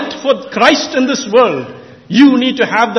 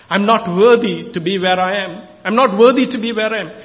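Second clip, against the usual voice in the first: usual speaking voice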